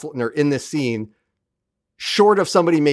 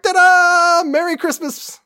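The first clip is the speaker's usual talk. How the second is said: much higher, loud showy ta-da; proud G'Kar; 4 dB louder, slower than usual. Retold or imitated. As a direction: present it like a proud magician. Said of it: "And he's like".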